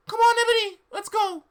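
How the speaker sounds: very high squeaky falsetto teacher; hammed-up shrill old witch; Trevally voice. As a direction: squeak it out like a dotty professor. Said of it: "Professor Trevally said".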